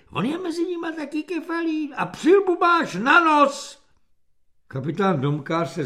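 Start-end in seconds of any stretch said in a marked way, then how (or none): 0.1-3.8 very high put-on girlish voice; high, piping heckle from a spectator; squealing girl. A narrator's reading. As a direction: squeal with delighted mockery. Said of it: "vypískla jedna z místních krasovic".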